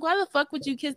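high-pitched indignant demand; incredulous, mad retelling; retold anger. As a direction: act out getting mad at a date. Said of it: "And I got mad".

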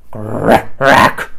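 loud growly beast shout; shouted crack. A narrator's reading. Beast shouting at the bird. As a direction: bellow it like a grumpy beast. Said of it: "shouted Grackle".

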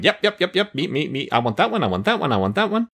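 high, quick eager voices; eager volunteering. Retold or imitated. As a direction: play the eager volunteer crowd.

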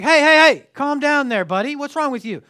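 high, loud call to break up a fight; retold shout. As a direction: yell hey at the bully to stop him. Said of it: "And I'm like".

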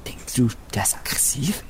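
hushed whisper near the beast. Acted. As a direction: whisper so it won't notice. Sneaking up on the panda.

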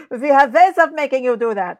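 sinister foreign interrogator, laughing; cool foreign spy menace. Pretend. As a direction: menace like a spy film villain. Classic interrogator line.

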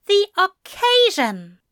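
high, loud, icy echo; affronted angel, frosty and high. A narrator's reading. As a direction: throw it back frostily, pitched well up. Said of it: "Aziraphale's voice went frosty".

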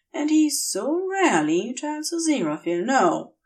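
ordinary delivery

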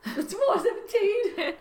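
very high, slow, laughing; silly echo. Pretend. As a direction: squeak it out through laughter. Echoes the silly time guess.

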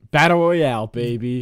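loud hype call; hype shout. Acted. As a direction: shout it like a fight announcer. Hyping the battle royale.

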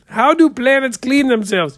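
high, loud setup line; joke setup, demonstrated. Shown as a demonstration. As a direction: deliver the setup like a showman. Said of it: "You say".

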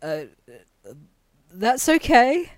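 stammering confused reply; mock flustered; flustered stranger. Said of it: "I just hear them go".

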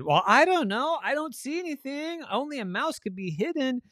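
high, searching puzzlement; puzzled sister. Acted.

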